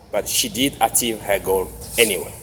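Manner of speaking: ordinary delivery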